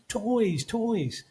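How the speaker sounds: put-on high child's voice, falling on each word; excited little kid. The kid's reaction to seeing toys, high child voice. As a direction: imitate a small child's delighted cry. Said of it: "the kid's reaction was".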